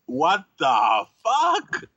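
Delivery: high, slow, shocked mock shout; shocked protester. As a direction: play a stunned protester. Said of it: "all these women had their face like".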